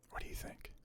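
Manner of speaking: soft unvoiced whisper; hushed conspiratorial whisper; whispered aside. Real, not acted. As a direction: whisper it to the person beside you. Aside to someone off camera.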